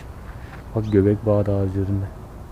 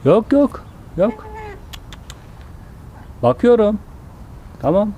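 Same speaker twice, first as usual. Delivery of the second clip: much higher, very slow placating voice; 10 semitones higher, slower than usual. Real, not acted. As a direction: soothe the llama so she won't attack. Backing off from the llama. (çok yavaş, tiz yatıştırma)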